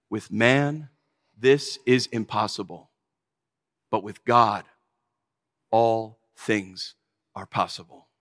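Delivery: low, hushed, deliberate pronouncement; calm reassurance. Retold or imitated. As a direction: let each phrase settle, pausing between them. Jesus calmly answers stunned disciples.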